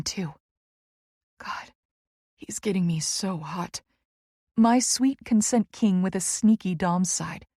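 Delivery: usual speaking voice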